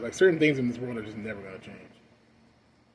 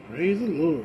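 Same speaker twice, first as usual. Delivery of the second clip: mock church greeting; 4 semitones higher, 4 dB louder, slower than usual. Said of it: "and she".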